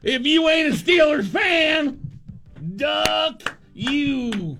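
loud chanted shout; shouted slogan. Pretend. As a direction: bellow it like a fan chant. Mock shirt slogan shouted.

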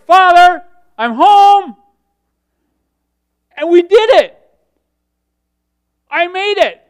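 high, very loud jubilant announcement; triumphant shout. Acted. Jesus calling to his Father on reaching heaven, triumphant shout. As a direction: call it out at full voice, like a victor home.

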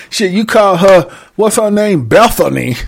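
ordinary delivery

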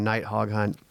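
ordinary talk

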